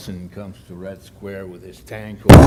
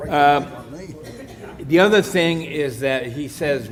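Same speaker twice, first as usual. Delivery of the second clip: louder, projecting to the room; speaking up; 4 semitones higher, 4 dB louder than usual. Real, not acted. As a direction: raise the voice so all can hear. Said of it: "I'll shout".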